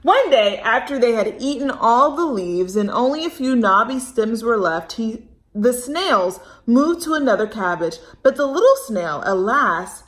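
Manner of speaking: put-on expressive read-aloud for kids; storybook reading. A narrator's reading. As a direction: storytime narration. Reads story to children.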